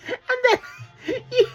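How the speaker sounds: high, slow squealing laugh; squealing giggle. Real, not acted. Laughing fit.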